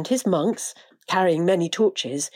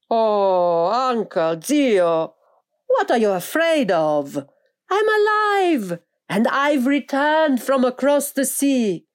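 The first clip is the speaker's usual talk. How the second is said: loud, high calling out to his uncle; 6 semitones higher, 5 dB louder, slower than usual. A narrator's reading. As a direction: call out joyfully across the church. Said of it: "continued to call to him".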